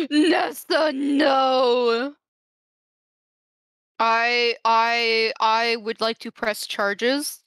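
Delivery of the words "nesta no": drawn-out, hammed-up cry of protest; mock wail. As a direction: wail it as if betrayed, playfully. Playful wail at friend's betrayal.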